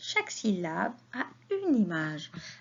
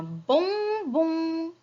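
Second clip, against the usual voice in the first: "bonbon" in two long, raised syllables; 6 semitones higher, much slower than usual. Shown as a demonstration. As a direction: stretch each "bon" slowly for young learners. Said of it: "Alors, bon".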